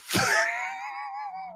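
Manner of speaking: high, squeaky laugh; helpless laughter. Real, not acted. Reader cracks up at an absurd sentence, helpless laughter. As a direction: break up laughing.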